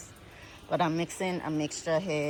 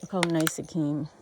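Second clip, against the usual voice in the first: normal talking voice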